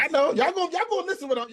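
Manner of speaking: high-pitched, cutting back in over an interruption; real high, insistent protest; insistent talking over. Real, not acted.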